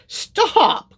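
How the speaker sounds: high, loud panicked cry. A narrator's reading. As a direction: yell it in a panic. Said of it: "cried Lord Gur".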